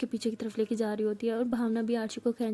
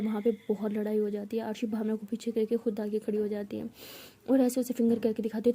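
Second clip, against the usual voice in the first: ordinary delivery, nothing marked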